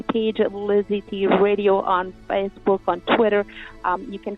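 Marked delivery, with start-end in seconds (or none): none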